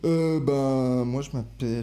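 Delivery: mock feminine voice, drawing the words out; put-on woman's voice. Man playing a woman introducing herself on a date, mock feminine voice. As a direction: play at being a woman, hesitantly. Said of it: "Pourquoi tu parles comme ça, mec?".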